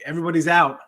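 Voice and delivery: normal talking voice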